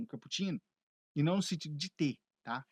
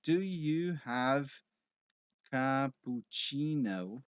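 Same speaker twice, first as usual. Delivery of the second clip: "Do you have cappuccino?" spoken very slowly, word by word; slow demo; 2 semitones lower, much slower than usual. Shown as a demonstration. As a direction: spell it out slowly for the learner. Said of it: "Você falaria simplesmente assim". (pergunta bem devagar, palavra por palavra)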